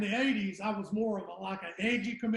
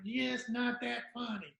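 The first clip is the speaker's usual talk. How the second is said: lightly imitated little old voice, slowed down; 3 semitones higher, 4 dB quieter, slower than usual. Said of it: "talked, you know, kinda".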